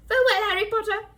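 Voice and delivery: elf farewell. Acted. Dobby's tearful farewell.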